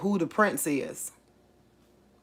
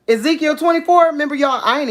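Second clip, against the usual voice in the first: excited burst; 8 semitones higher, 4 dB louder, much faster than usual. Real, not acted. Excited reveal of verse.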